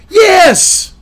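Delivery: high, loud burst of "Yes!"; sudden exasperated yell; exasperated shout. Real, not acted. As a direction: shout it back as an obvious retort. Exasperated retort to the quote.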